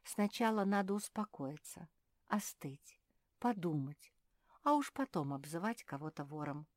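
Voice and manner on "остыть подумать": ordinary delivery, nothing marked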